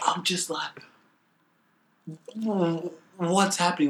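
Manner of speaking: amazed disbelief. Retold or imitated. Athlete recalling a cheering stadium, amazed disbelief. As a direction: relive being stunned by the crowd. Recounting own stunned reaction to crowd.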